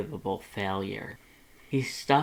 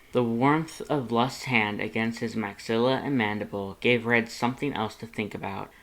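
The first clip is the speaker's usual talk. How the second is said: ordinary delivery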